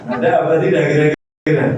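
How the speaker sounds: ordinary talk, nothing marked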